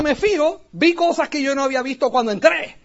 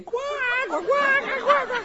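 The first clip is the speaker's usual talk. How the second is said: high squeaky duck-like cackle, much higher; quacking laugh imitation; 11 semitones higher, 5 dB quieter, slower than usual. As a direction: mimic his friend's laugh like a quacking duck. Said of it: "Eddie se tira a reír".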